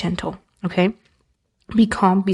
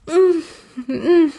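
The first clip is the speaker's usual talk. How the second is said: high, wavering "mm, mm" of hesitation; singsong uncertain hum; high hum of doubt; 8 semitones higher than usual. Shown as a demonstration. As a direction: hum a playful maybe. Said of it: "depends on how you say it".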